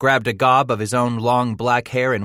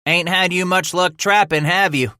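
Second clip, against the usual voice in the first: teasing sidekick; 10 semitones higher, 5 dB louder than usual. Acted. Sidekick teases captive.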